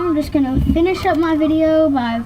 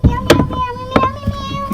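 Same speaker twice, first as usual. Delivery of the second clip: high, playful meowing like a kitten; cat meows; 11 semitones higher, slower than usual. Pretend.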